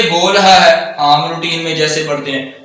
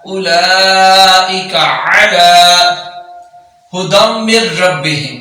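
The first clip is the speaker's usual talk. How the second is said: slow, measured tajweed recitation; Quran recitation; much slower than usual. Acted. Teacher recites the verse slowly for students. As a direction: chant it slowly, drawing out each vowel.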